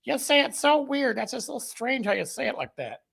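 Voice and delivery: mock his family, teasing him, with the pitch way up. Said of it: "They're like".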